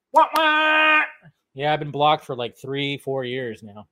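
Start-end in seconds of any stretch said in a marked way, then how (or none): 0.1-1.0 high, hammed-up sad trombone wah; mock whine. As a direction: whine it like a cartoon loser. Mocks being blocked.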